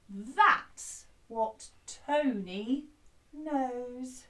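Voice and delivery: slow, word-by-word teaching delivery; drawn-out spoken line, not sung; slow spoken lyrics. Shown as a demonstration. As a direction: say it slowly for the class to repeat. Teaching the song words slowly.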